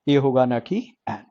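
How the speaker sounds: ordinary delivery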